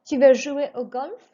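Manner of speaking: French question, rising on golf; rising question tune. Shown as a demonstration. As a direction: model the question, ending on a rise at golf. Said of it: "raise your voice just before the question mark".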